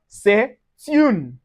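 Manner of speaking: "c'est une" as seh, tune; T of "c'est une" starts the next syllable; linked pronunciation. Shown as a demonstration. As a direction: pause after seh, then "une" with a T in "c'est une". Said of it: "pronounce the T together with the next vowel".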